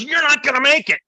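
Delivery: put-on sudden high, loud snarl of a drunk veteran; menacing threat. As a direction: spit the threat out at him. Drunk Santa threatening the narrator.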